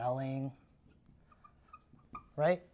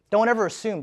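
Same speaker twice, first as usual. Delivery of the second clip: emphatic warning; 5 semitones higher, 8 dB louder than usual. Real, not acted.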